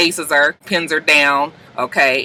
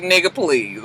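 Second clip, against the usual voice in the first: dismissive scoff; 4 dB quieter than usual. Retold or imitated. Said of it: "her faces would be like".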